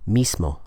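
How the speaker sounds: "mismo" with a voiceless hissed S before M; without assimilation. Shown as a demonstration. Teacher showing the acceptable unassimilated form, "mismo" with true S. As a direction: keep a clean unvoiced S in "mismo". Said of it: "you can pronounce it without assimilation".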